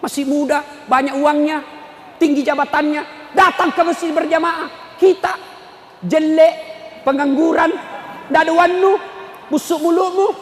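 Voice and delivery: high, taunting tirade, punched phrase by phrase; mock scolding. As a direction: build a hammed-up scolding to a loud jeer. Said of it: "Coba, Maki".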